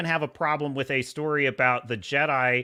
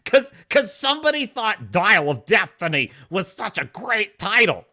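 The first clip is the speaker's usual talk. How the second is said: normal talking voice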